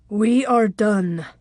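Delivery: slow, cold finality; cold, controlled and unhurried; cold and calm. A narrator's reading. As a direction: state it slowly, with calm detachment. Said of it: "said in a cold, calm voice".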